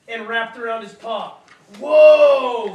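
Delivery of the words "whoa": very loud surprised "Whoa!"; startled yelp. Acted. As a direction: yelp as you are yanked. Yanked by a rope.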